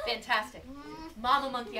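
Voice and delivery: ordinary delivery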